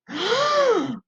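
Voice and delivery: sharp, high shocked gasp; crowd gasp. A narrator's reading. The crowd seeing Fiona still an ogress, a shocked gasp. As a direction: gasp in surprise. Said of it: "the crowd gasped".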